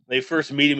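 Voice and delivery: usual speaking voice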